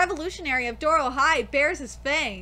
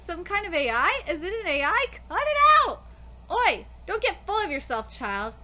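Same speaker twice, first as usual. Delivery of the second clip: normal talking voice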